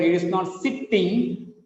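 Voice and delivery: "sitting" with two separate t sounds, sit-ting; wrong double t. Shown as a demonstration. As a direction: pronounce both t's in "sitting". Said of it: "not the double sound".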